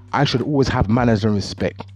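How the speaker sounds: ordinary talk, nothing marked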